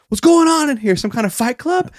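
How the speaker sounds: high-pitched mock yell; imitated coach screaming. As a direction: burst in like an outraged coach. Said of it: "the coach comes in and screams out".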